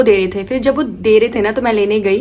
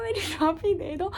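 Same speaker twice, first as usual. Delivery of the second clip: softer, less high मेरी trophy दे दो, trailing off; fading sobbing plea; 7 semitones higher, 11 dB quieter than usual. Retold or imitated. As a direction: let the plea for मेरी trophy die away. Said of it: "मैं बस रोती गई". (धीमी होती रोती आवाज़ में "मेरी ट्रॉफी दे दो")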